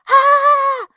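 tiny creature's piercing high shriek; creature squeal. A narrator's reading. The newborn elf shrieks at the children, piercing high. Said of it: "Существо ... и взвизгнуло".

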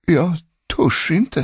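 high, slow, quavering fear; trembling servant. A narrator's reading. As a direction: whimper it as a frightened servant. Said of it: "Betjänten började skaka i hela kroppen". (darrande rädd, gällt och långsamt)

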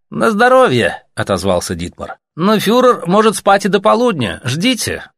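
a bureaucrat brushing off visitors at night; cool Reich officer. Acted. Reich officer answering the messengers, officious.

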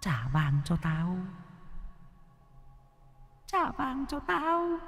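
high, soft, slow ghostly demand; a faceless ghost wailing for its gold; haunting ghost chant; eerie ghost voice. A narrator's reading. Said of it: "Âm thanh thánh thót".

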